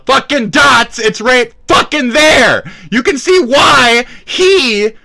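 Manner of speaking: high, loud exasperation; angry shout. Real, not acted. Streamer insisting the dots connect, angry shout. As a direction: yell it out, pitch jumping up. Angry rant at absent rival.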